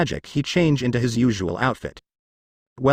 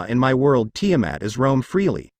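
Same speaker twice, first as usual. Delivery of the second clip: plain reading voice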